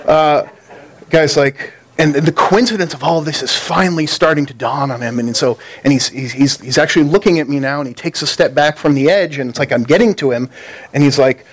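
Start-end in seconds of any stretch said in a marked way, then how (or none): none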